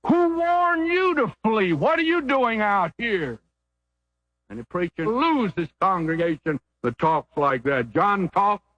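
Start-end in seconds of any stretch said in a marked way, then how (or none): 0.1-3.4 high, slow denouncing cry; fiery prophet rebuke. Retold or imitated. Preacher voicing John the Baptist rebuking the crowd, high and slow. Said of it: "He said".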